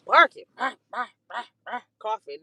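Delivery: short loud bah bah coughs; mock cough barks; imitated barking cough. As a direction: bark it out like a sick cough.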